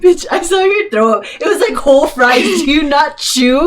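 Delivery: put-on high, incredulous drunk scolding; mock outrage. Drunk friend scolds pal who threw up, high incredulous outrage. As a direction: berate her in comic disbelief.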